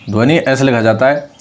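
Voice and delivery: usual speaking voice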